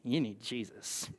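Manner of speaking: put-on slow, low and soft Southern accent; hushed, drawn-out Southern accent; Southern lady accent. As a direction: drop into a quiet Southern lady drawl. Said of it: "I always have that accent".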